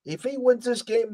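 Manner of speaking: normal talking voice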